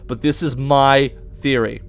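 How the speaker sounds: ordinary delivery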